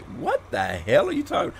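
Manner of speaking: mock-incredulous, swooping pitch on the question; exaggerated "what on earth?" disbelief; retold bafflement. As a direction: act out total bewilderment at an odd invitation. Retold baffled reply to Australian mate.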